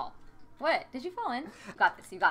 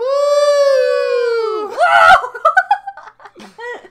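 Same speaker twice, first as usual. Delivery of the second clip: long high laughing scream; shrieking laugh; 13 semitones higher, 15 dB louder than usual. Real, not acted. Player bursts out as the village burns, shrieking laugh.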